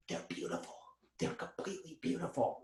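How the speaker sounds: breathy whispered awe, barely voiced; awed whisper. Friend whispering to John about the girls, awestruck whisper. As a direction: whisper it like you can't believe your eyes.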